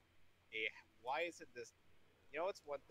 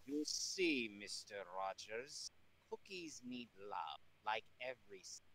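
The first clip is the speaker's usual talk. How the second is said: put-on German accent; 6 semitones lower, 5 dB quieter than usual. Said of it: "I heard him start to do the accent".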